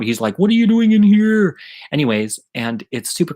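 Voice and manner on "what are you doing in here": high, loud imitated outraged yell; freaking-out brother. Brother catches sister in the hallway, freaking out. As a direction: mimic a brother losing it. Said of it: "He's freaking out".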